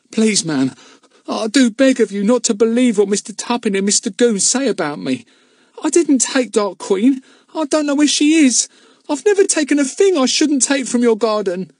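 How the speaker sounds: begging his mistress to believe him; urgent plea. A narrator's reading. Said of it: "in an urgent voice".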